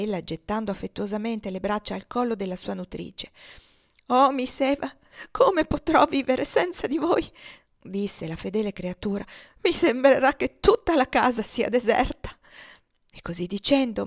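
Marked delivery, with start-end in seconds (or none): 4.1-7.3 high, loud desperate cry; despairing. A narrator's reading. Nurse to dying Eva, can't live without her, despairing. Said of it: "si dava alla disperazione". 9.6-12.4 loud, raised lament; despairing. A narrator's reading. Mamie giving in to grief over Eva, despairing. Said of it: "si dava alla disperazione".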